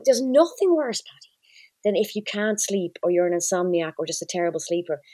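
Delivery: ordinary talk, nothing marked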